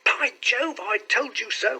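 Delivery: high, triumphant exclamation; excited cry. A narrator's reading. Clerk spotting his employer in the street, excited cry. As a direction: let the pitch leap in vindication. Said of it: "cried the clerk".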